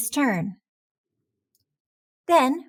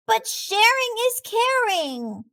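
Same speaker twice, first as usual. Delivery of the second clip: high insistent protest; a young shark crying out that it's unfair; 11 semitones higher than usual. A narrator's reading. Clark protests to his teacher about sharing, a high insistent cry. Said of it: "cried Clark".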